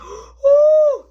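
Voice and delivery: high, loud shocked gasp and ooh. Retold or imitated. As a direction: gasp at the wrecked room. Deaf mama sees the room.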